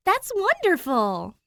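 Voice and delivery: high, loud and slow with joy; slow delighted exclamation. Acted. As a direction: gush it out, thrilled. Jazz claps hands, delighted.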